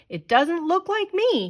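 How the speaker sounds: high, indignant roar. A narrator's reading. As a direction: bellow at the portrait in outrage. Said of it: "he roared".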